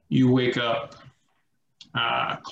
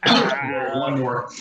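ordinary delivery

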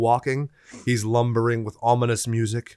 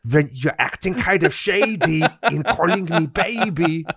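put-on slow, higher German-accented singing; 6 semitones higher than usual. Singing the parody in a German accent, slow. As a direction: croon it like a German singer. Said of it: "in the Third Reich sound".

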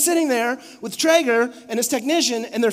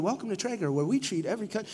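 automated greeting; 2 semitones lower, 9 dB quieter, faster than usual. Retold or imitated. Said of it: "like, the little automated".

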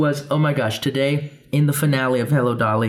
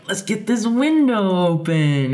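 fake stalling; 7 semitones higher, slower than usual. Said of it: "so we're like".

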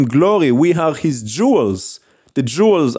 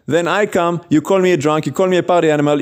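ordinary delivery

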